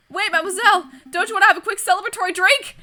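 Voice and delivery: high, loud call after someone leaving; calling after her. Acted. Calling after the departing mademoiselle, loud and high. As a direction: shout after her before she's gone.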